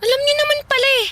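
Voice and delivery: high, fast scornful snap; defiant daughter sneering at her stepmother; sarcastic retort. Acted. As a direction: throw it back at her, mocking. Daughter snapping back at stepmother.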